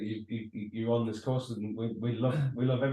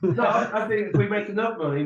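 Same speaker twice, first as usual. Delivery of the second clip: higher, louder, through laughter; laughing; 7 semitones higher, 5 dB louder than usual. Real, not acted. Joining in a co-host's skating rink joke, laughing. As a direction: talk while still laughing.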